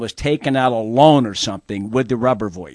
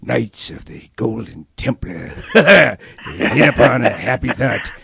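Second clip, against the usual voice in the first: Sidney Greenstreet impression; 3 semitones lower than usual. Host does his Maltese Falcon villain for the guest. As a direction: savor each word like a plump film villain. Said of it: "That's very good".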